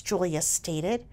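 ordinary delivery

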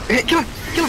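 urgent shout. Real, not acted. Urging friends in fight.

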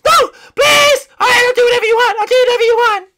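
very high, loud princess shriek; hammed-up squealing surrender; panicked pleading. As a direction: beg frantically at the top of your voice.

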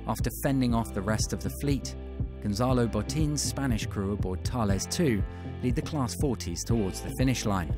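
ordinary talk, nothing marked

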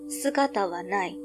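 ordinary delivery

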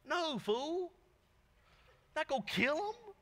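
high, slow mock scolding; Jesus telling John off, hammed up. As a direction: set the fool straight in a put-on voice. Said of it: "Jesus basically looks at him and goes".

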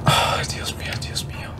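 barely audible prayer of fear; terrified whisper. Real, not acted. Player hiding from the monster, whispering in fear. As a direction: whisper it, afraid to be heard.

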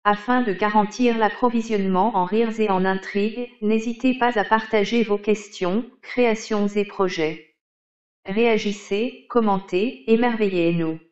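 robotic text-to-speech read; monotone computer voice reading aloud; old speech synthesizer. Acted. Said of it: "Vous avez sélectionné Microsoft Hortense Desktop".

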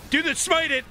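panicked high shouting; man down shout. Real, not acted. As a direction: yell at the team to run. Panicked teamfight retreat in game.

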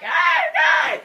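loud, high mock scream; imitating a panicked yell; reenacted startled scream. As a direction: act out your own freak-out. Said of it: "I was like".

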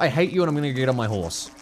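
ordinary talk

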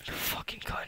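unvoiced hissed curse; whispering. Real, not acted. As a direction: breathe the insult out.

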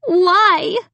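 high, upset, trembling question. A narrator's reading. Sister confronting Amy over her walls, trembling. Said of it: "asked me in a trembling voice".

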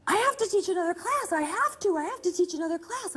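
high, fast, pleading insistence; imitated frantic, high-pitched pleading; intense. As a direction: rattle it off in feverish urgency. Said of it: "started this kind of intense".

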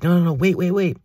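high loud desperate begging; panicked pleading. Retold or imitated. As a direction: beg him to stop, voice shooting up. Begging attacker in dream.

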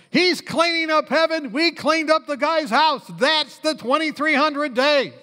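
high-pitched, punchy sermon delivery; rousing preacher's emphasis; emphatic preaching. Real, not acted. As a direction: drive the point home to a congregation. Preaches the climax with force.

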